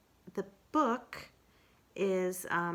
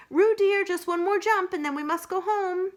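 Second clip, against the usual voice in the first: much higher, louder motherly call; Kanga calling Roo; 12 semitones higher, 8 dB louder than usual. Acted. Kanga calls Roo to come home, much higher. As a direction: call out to your child across the field.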